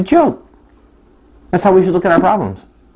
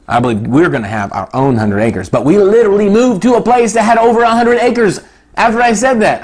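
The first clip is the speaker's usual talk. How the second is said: usual speaking voice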